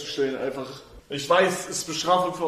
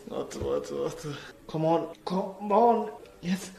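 ordinary talk, nothing marked

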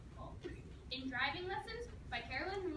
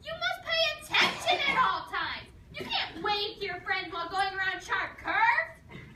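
much higher, louder mock yell; mom yelling; 6 semitones higher, 9 dB louder than usual. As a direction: shout it like a panicked mom. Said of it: "Her voice would get higher and louder".

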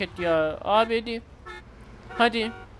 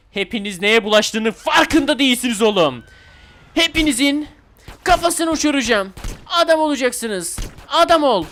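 loud, higher mock rage yelling; hammed-up furious threats; 5 semitones higher, 6 dB louder than usual. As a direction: bellow threats like a movie gangster.

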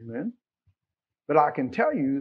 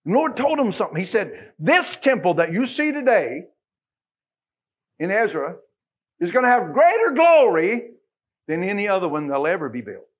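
loud, ringing pulpit voice; fervent revival preaching; preaching at full force; 4 semitones higher, 9 dB louder than usual. Acted. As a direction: preach it out to the back pews.

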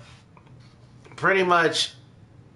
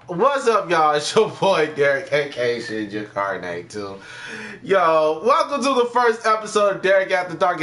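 usual speaking voice

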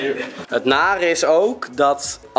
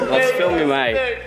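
laughing; slower than usual. Real, not acted. Laughing fit after finding.

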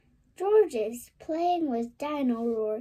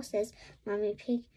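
plain reading voice

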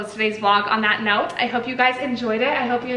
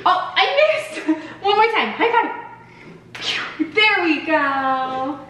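playful laugh; 5 semitones higher, slower than usual. Real, not acted. Playful high five.

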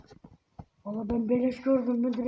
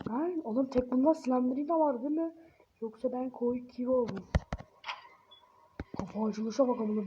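ordinary talk

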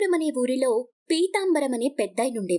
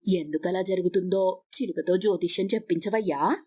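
much lower-pitched neighbour voice; nosy neighbour woman; 7 semitones lower than usual. Acted. Neighbour asking for a parrot's fortune-telling, low-voiced and pressing. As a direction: play the prying neighbour, pitched well down.

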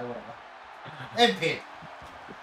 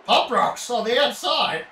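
louder hyped play-by-play; excited commentary; 6 semitones higher, 7 dB louder than usual. Real, not acted. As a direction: call the action with energy. Calling wrestling game action.

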